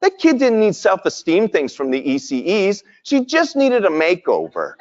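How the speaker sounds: amused retelling; chuckling punchline; laughing. Retold or imitated. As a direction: laugh through the quote. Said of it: "we started to laugh going".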